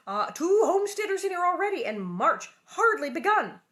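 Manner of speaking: high, loud fussing neighbour voice; fretting neighbour woman. A narrator's reading. Mrs Boast frets to Pa, high and loud. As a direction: complain loudly about the crowd.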